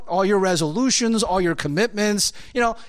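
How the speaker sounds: ordinary talk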